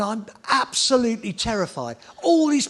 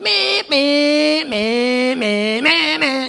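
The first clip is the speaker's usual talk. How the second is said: high, loud choppy engine blips; imitated revving bikes; 7 semitones higher, 6 dB louder, much slower than usual. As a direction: mimic short, jerky throttle revs. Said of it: "you could hear them going".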